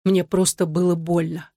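plain reading voice

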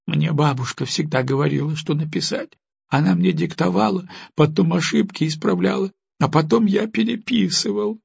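высоко, сдавленно, через силу; выдавить признание сквозь слёзы; выдавил сквозь слёзы. A narrator's reading. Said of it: "выдавил он". (мальчик сквозь слёзы признаётся родителям)

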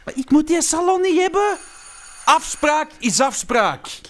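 insistent. Acted. Arguing over the deal.

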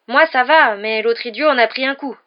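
high, loud reply through stone; calling back. A narrator's reading. Ron answers Harry through the rubble, loud. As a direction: shout reassurance across the rockfall.

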